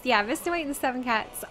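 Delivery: ordinary delivery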